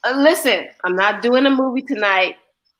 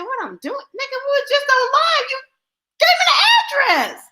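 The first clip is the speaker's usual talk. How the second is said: incredulous yell; 13 semitones higher than usual. Retold or imitated. Retold confrontation, yelling.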